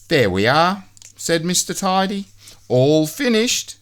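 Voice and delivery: high and satisfied; pleased little men. A narrator's reading. Tidy and Neat finish cleaning, high and pleased. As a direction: proudly announce the job done. Job done.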